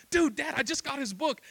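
high, excited outburst to dad; thrilled reply. Retold or imitated. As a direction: blurt it out, amazed at the match. Son's thrilled reply acted out.

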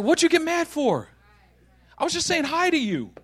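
high-pitched innocent protest; baffled protest. Acted. Friend to angry Josh, baffled and innocent.

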